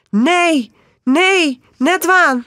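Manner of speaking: high, loud terrified cries of no; frightened woman screaming no; panicked scream. A narrator's reading. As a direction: scream in panic to push him off. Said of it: "Die Pika begint te schreeuwen".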